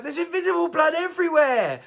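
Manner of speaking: very high, loud mock shriek; hammed-up hysterical kid; child going nuts. Child shrieks at hairdresser over imaginary injury, mock hysteria. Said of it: "him going nuts".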